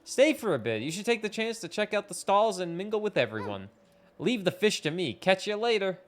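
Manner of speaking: lightly voiced caring friend; caring villager voice. Reads game character lines.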